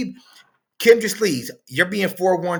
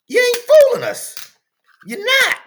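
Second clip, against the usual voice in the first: loud, high call-out; 9 dB louder than usual. Real, not acted. Host calls out women putting on a facade, loud high yell. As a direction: yell it straight at them, not buying it.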